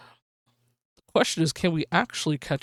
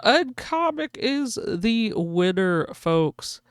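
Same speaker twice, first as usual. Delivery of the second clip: announcer voice; 4 semitones higher, much slower than usual. Acted. Announcing race winner.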